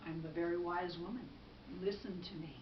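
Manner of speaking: lightly voiced low, soft counsel; assured wise woman. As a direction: answer low and softly, with quiet authority. Wise woman reassures the man.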